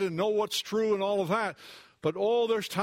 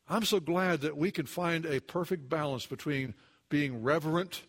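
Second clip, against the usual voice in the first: ordinary talk, nothing marked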